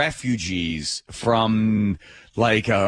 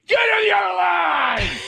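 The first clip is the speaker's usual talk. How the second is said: screaming employee. Acted. Bank worker ordering customers to another line, screaming. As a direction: scream it like an agent herding customers. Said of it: "someone working for that business to scream at you".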